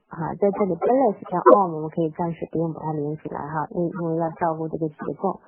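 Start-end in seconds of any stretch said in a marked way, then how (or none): none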